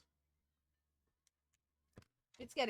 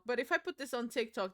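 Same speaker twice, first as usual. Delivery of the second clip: usual speaking voice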